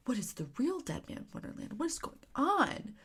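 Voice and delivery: fast, hushed, intrigued whisper. Real, not acted. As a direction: whisper it like a secret. Hooked reviewer, rhetorical question.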